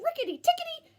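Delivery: ordinary delivery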